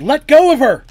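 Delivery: very loud, high screamed command. A narrator's reading. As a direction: scream it at full force, protecting your child. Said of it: "looked at Menandra and screamed".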